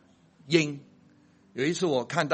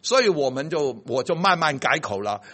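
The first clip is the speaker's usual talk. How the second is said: normal talking voice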